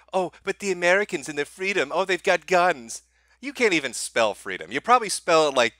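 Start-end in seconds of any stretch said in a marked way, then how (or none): 0.1-3.0 mock European whine. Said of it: "don't even try to tell me".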